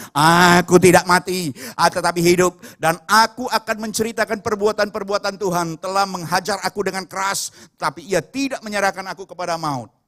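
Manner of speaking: booming refusal to give in; defiant proclamation. Retold or imitated. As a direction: defy death at full voice. Defiant declaration against voice of death.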